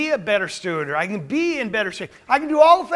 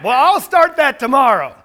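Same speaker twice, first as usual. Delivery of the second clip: "Well, I'll start that tomorrow" said louder and higher, a hammed-up excuse; louder mock excuse; 5 semitones higher, 9 dB louder than usual. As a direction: push the excuse out loud as a parody of yourself. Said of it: "We tell ourselves that a lot".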